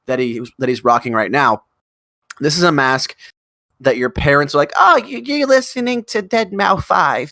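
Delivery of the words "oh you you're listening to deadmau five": put-on high, drawn-out cooing parent in an odd accent, dropping at the end; slow, hammed-up patronizing parent; mock parent voice. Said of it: "I don't know what that accent that was".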